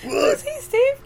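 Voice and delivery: high mock quote. Mock movie line quote.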